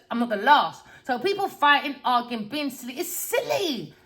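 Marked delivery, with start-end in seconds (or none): none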